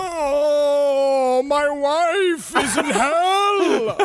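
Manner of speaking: high, slow, wailing ghost voice. Shown as a demonstration. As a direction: moan it out like a spooky ghost. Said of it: "That's my ghost voice".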